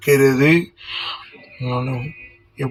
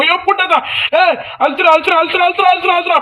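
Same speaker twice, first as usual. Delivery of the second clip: frantic urging; 13 semitones higher, faster than usual. Real, not acted. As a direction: scream the command again and again. Urging during a fight.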